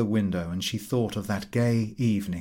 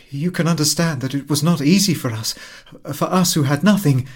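louder, higher insistence; emphatic; 5 semitones higher, 6 dB louder, faster than usual. Acted. Mathilde telling her friend of their struggle, louder. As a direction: press it home with pride. Mathilde's proud hard account.

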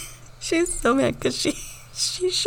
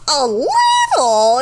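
imitating a husband's voice shooting up high; loud, drawn-out, high-pitched shriek of disbelief; super high; 4 semitones higher, 7 dB louder, much slower than usual. Retelling a husband's poop-prank reaction, super high. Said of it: "his voice goes super high".

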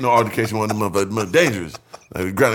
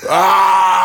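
loud, higher yelling scream; mock scream; 5 semitones higher, 6 dB louder than usual. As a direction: yell it out, running off laughing. Retold friend screaming in the mall.

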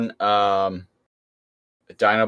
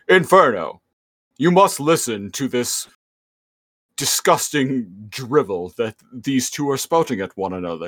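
a lordly robot tyrant grumbling; Megatron; 3 semitones higher than usual. Retold or imitated. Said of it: "he was like".

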